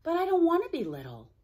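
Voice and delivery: high, quick little-car voice; a small streetcar pleading to himself; young voice, thinking aloud. Acted. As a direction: rush it out, hurt and stubborn. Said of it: "Charlie thought to himself".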